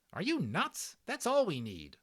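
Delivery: put-on high loud scoffing kid voice; exasperated boy. Patrick mocking Omri for missing the belly, high scoff. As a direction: roll your eyes at your friend.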